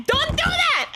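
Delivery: pleading second warning. Real, not acted. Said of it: "Do not hide in their bedroom".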